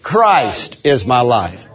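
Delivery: Christ drawn out and stressed, slow. Shown as a demonstration. Preacher emphasizing each word in turn, stress on Christ. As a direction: lean long on the word Christ. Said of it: "Like to emphasize each word".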